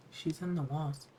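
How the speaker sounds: put-on soft, flat eerie child. A narrator's reading. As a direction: say it flat and soft like a haunted kid. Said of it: "voice flat".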